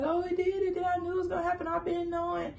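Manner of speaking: high, soft lightly voiced dad; a hint of a smug father; knowing dad. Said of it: "he was like".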